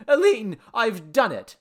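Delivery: high, loud triumphant announcement; excited cry. A narrator's reading. Man announcing success to his girl, crying out. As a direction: burst out with the news. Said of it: "cried Henry".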